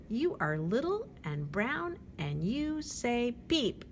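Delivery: ordinary delivery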